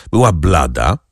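ordinary delivery, nothing marked

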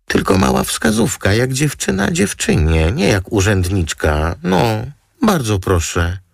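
very high, cajoling model voice; high-pitched girlish pleading; wheedling model. A narrator's reading. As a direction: coax sweetly, pitched right up.

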